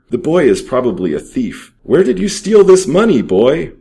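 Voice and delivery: put-on bully the captive boy; menacing question. A narrator's reading. Thief interrogating the captive boy, loud and menacing. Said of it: "replied Pierre".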